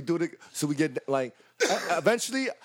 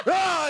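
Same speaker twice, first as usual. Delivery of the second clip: mock battle cry; 5 dB louder than usual.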